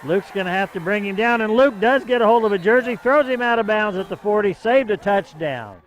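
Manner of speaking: loud, rising excitement on the call; excited play-by-play. Real, not acted. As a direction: shout the tackle as it happens. Big return, touchdown saved.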